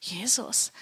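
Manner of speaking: breathy whisper of dismay; whispered Jesus. Retold or imitated. Retelling her own fright, she whispers the name. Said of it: "ich so".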